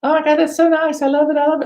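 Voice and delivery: very high, gushing child imitation; put-on kiddie voice full of delight; excited little kids. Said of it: "the kids were, like, seven".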